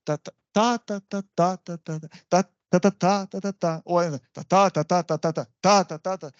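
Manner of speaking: chanted TA-ta-ta beats in threes; waltz rhythm. Shown as a demonstration. Teacher showing that these feet are waltzes, rhythmic chant. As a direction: tap out the waltz count with the voice, one strong TA in three. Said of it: "all of these are waltzes".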